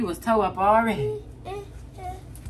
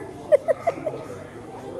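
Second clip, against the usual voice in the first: high laugh; 4 dB quieter than usual. Real, not acted. Laughing at the cigar joke.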